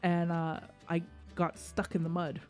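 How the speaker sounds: usual speaking voice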